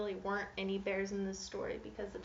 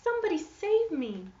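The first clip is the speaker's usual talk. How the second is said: high, loud damsel plea; princess pleading; 9 semitones higher, 8 dB louder than usual. Acted. Princess in distress, high loud plea. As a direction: call for rescue like a storybook princess. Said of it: "cried the princess".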